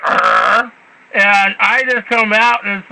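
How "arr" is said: low mock dog growl; imitated dog growl. Telling how the dog met the census man, low imitated growl. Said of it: "My dog is like".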